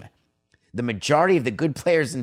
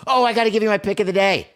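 remembering with a burst, higher, louder and fast; oh, almost forgot, louder and quicker; sudden excitement; 9 semitones higher, 4 dB louder, faster than usual. Real, not acted. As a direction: blurt it out as it suddenly comes to mind.

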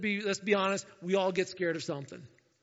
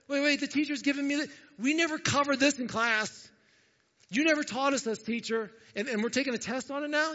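high, louder mock protest; frustrated student; 8 semitones higher, 4 dB louder than usual. Student protesting to the teacher about an unfair test, acted frustration. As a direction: act out an indignant pupil objecting to the test. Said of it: "there's nothing more frustrating like this".

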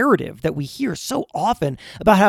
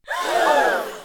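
sharp high gasp of mock shock; dramatic gasp. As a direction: gasp as if scandalized. Gasp after announcing name change.

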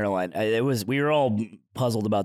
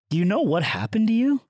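usual speaking voice

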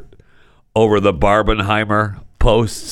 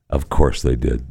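usual speaking voice

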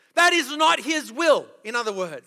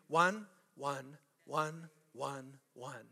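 fading quiet; 11 semitones lower, 13 dB quieter, much slower than usual. Acted. Counting down to a whisper.

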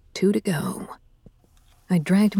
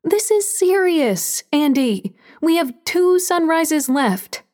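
high, slow, stern anger; angry; 8 semitones higher, slower than usual. A narrator's reading. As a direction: scold him, each word pressed. Angry argument in kitchen.

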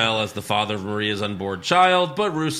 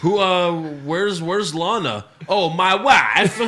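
put-on loud, high Bulgarian accent, laughing; hammed-up Borat accent, cracking up; 5 semitones higher, 5 dB louder, slower than usual. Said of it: "he's Borat".

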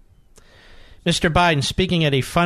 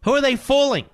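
scoffing; 12 semitones higher than usual. Real, not acted. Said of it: "host mocks party".

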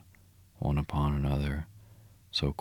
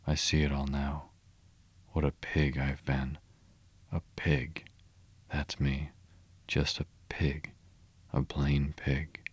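plain reading voice

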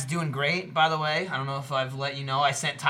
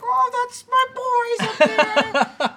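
much higher, falsetto doting mom; mom impression; 4 dB louder than usual. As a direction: gush like a proud mother. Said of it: "she was like".

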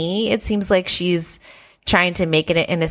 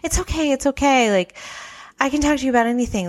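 put-on reassuring girl; 8 semitones higher, faster than usual. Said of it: "be like".

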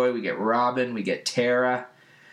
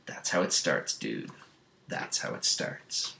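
low, hushed, sinking away; mock-grave murmur, slowing on the repeat; ominous warning; 12 semitones lower, 6 dB quieter than usual. Beast Boy warns Robin about the pats, darkly hushed.

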